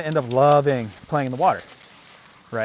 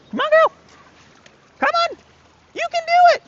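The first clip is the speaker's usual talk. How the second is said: much higher, louder encouragement; high-pitched dog coaxing; 7 dB louder than usual. Real, not acted. Trainer urges his dog to cross, squeaky high praise. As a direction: cheer the dog across the water.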